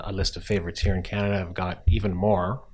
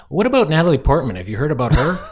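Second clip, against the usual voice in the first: naive distributor voice; 8 semitones higher, 9 dB louder, faster than usual. Retold or imitated. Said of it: "one of them said to me".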